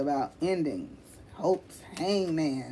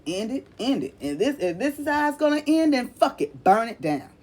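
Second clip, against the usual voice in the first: louder, higher mock outburst of giving up; fed-up mock outburst; 6 semitones higher, 5 dB louder than usual. Reader imitates Gemini giving up in a louder, higher mock outburst. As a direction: play the client shouting fuck it, burn it down. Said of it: "You like".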